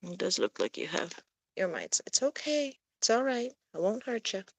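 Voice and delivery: soft, hushed reassurance; calm a frightened kitty quietly; gentle murmur so the cat won't bolt; soothing a scared cat. Real, not acted.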